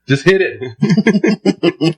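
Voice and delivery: slow, stretched out, laughing at own advice; drawn out. Real, not acted. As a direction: stretch "Just hit it" out slowly, every word long. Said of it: "repeated advice, drawn out".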